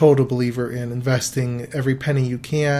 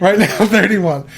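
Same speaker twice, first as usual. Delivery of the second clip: higher, louder, half laughing; playful insistence; 6 semitones higher, 7 dB louder than usual. Real, not acted. Teased about age, she insists laughingly. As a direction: firmly plant it, grinning.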